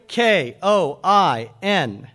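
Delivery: slow letter-by-letter spelling; deliberate spelling-out. Shown as a demonstration. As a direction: spell each letter slowly. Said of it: "You want me to spell it for you?".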